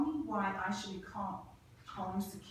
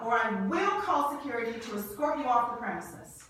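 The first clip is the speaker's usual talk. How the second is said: raised, threatening voice; angry threat; 3 semitones higher, 8 dB louder than usual. Acted. Eve threatening James with campus security, angry. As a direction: keep the anger up as you threaten.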